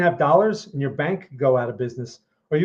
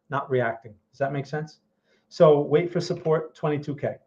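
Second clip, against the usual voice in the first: ordinary talk, nothing marked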